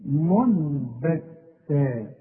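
ينبغي and منبتا with slow hummed meem; iqlab ghunna. Shown as a demonstration. Teacher recites examples for students, very slow ghunna. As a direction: drag the nasal hum very slowly. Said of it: "أهي".

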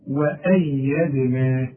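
وأيدنا drawn very slowly and lightly; slow light recitation. Shown as a demonstration. Teacher showing the light vowels in recitation. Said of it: "كلها مرققة".

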